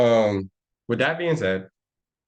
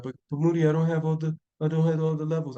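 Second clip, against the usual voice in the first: whiny student; 5 semitones higher than usual. Retold or imitated. Student complains to Moody about missing levels, mock whine. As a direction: play a confused student begging Moody. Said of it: "You're gonna be like".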